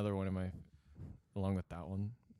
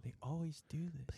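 whispering; 4 semitones higher than usual. Pretend. Mock complaint in whisper.

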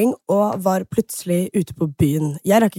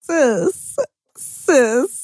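sassy English put-down; mock diva scolding; 3 semitones higher, slower than usual. Friend roasting her for flashing followers, sassy mock scolding. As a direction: roast her like an outraged sis.

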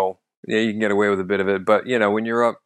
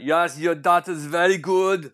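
slow, higher mock German accent; 6 semitones higher than usual. Imagined German teacher praising a daughter to parents, German accent. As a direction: play a German teacher speaking careful English.

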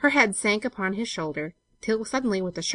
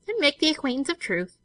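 higher-pitched, airy teasing; light teasing; 9 semitones higher than usual. A narrator's reading. Olive teasing her companion at the well, lightly. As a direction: tease lightly in a high voice. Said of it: "said Olive lightly".

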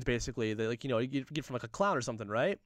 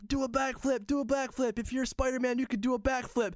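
put-on high-pitched pestering children; excited kids; 9 semitones higher than usual. Kids urging Spider-Man to do a trick, high kid voices. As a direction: imitate little kids chanting a demand. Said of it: "a lot of the kids are like".

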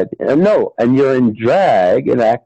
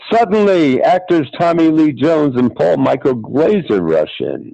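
plain reading voice

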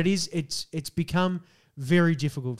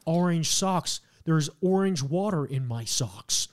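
careful, exaggerated American demo; overdone American vowels and r's; American accent; slower than usual. Shown as a demonstration. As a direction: try a self-conscious American accent. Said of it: "without sounding like you're a fucking idiot".

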